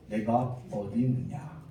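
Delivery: slow, low, soft question; calm, gentle tone. Retold or imitated. God quietly asking Adam where he is, calm and slow. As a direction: ask it softly, taking your time. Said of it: "잔잔하게 부르셨습니다".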